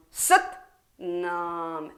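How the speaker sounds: Sat snapped, Nam relaxed and plain; sharp then relaxed. Shown as a demonstration. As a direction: punch Sat, release Nam. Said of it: "the nam is a relaxation".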